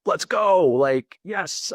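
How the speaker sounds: high, loud burst of eagerness; excited. Retold or imitated. Man thrilled at the massage offer, excited. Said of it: "I was so excited".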